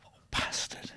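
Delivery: unvoiced whispered oh bastard; whispered curse. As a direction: breathe the curse under your breath. Dreading the officer at the door.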